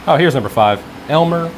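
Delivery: normal talking voice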